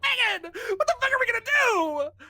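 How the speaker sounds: high, loud desperate cry at Megan; terrified shout. Acted. McKenna turns to Megan, screaming in terror. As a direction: scream the question in her face. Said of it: "she's so beyond terrified".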